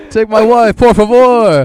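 high, loud hammy take my wife; mock Spanish accent. Co-host joining the comic bit, in a hammy Spanish accent.

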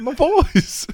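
high laughing coo; doting mom voice. Retold or imitated. Repeating Martha.

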